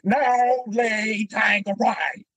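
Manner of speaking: put-on high, very slow, shouted Southern accent; frustrated Southern accent. Rickman fed up after many takes, shouted slow Southern accent. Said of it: "he's getting frustrated".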